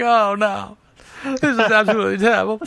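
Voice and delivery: loud, high mock wail, quickened; hammed-up newsreel reporter in tears; bawling old-timey announcer. Imitating the Hindenburg reporter, bawling in old-timey voice. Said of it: "the dude was fucking bawling".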